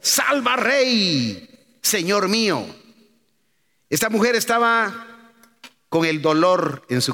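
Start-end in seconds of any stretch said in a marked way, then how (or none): 0.0-2.7 high, drawn-out plea, only mildly raised; imitated cry for help. Woman begs the passing king to save her, mock cry. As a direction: call out as a desperate woman, not full force. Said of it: "una mujer le gritó y dijo".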